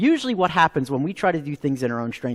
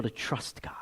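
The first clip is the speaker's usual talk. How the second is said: whispered emphasis; 7 semitones lower, 15 dB quieter than usual. Real, not acted. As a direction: whisper the key words. Sermon key point dropped low.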